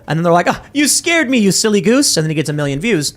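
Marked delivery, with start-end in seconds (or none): 0.5-2.2 high-pitched, teasing scolding; lightly hammed-up squeal of relief; playful mock fright. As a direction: act out a tickled prank victim, pitched way up. Playful reaction to prank, acted.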